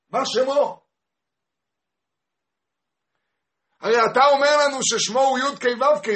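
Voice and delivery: high, loud shouted questions; impassioned demanding. Acted. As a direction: hurl each question as a challenge. People demanding answers about God's name. (תביעה בצעקה נרגשת)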